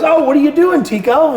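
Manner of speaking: high, lightly acted surprised question; wife's surprised greeting. Wife walks in on the lesson.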